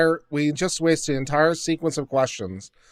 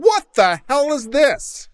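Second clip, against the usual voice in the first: higher, slow incredulous question; exasperated disbelief; 8 semitones higher, slower than usual. Real, not acted. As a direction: drag it out in utter disbelief. Outraged at the exchange.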